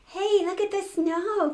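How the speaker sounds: put-on high, loud excited kids; high excited shout of children; excited children crying out. A narrator's reading. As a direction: point at the snow with delight. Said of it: "cried the children".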